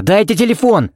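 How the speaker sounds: high, urgent demand; sudden shout. A narrator's reading. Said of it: "крикнул он неожиданно громко".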